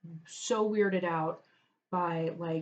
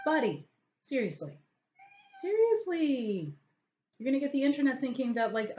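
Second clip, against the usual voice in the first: exasperated; 7 semitones higher than usual. Real, not acted. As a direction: plead with the cat in disbelief. Scolding the cat directly.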